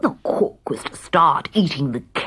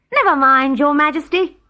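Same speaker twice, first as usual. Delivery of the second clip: higher, louder comforting call; calling cook; 6 semitones higher, 4 dB louder than usual. Acted. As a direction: reassure the hungry king. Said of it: "called the cook".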